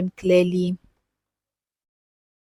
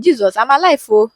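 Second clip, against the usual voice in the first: relieved exclamation; 4 semitones higher, 7 dB louder than usual. Retold or imitated. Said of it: "I was like".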